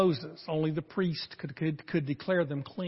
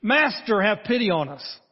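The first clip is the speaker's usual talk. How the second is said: raised, pleading call from a distance; calling out loudly; 5 semitones higher, 7 dB louder than usual. A narrator's reading. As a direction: lepers crying out for pity, pitched up and louder. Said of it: "called out in a loud voice".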